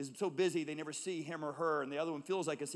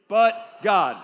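a full-voiced shout of But God, much louder; 5 semitones higher, 20 dB louder than usual. Shown as a demonstration. Congregation reading the first two words together, shouted. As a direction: proclaim it like a battle cry. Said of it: "Loudly, like we mean it".

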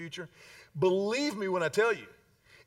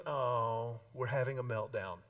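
calm mature mother; 7 semitones lower, 5 dB quieter than usual. Retold or imitated. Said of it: "the mature mother looks at that little five-year and goes".

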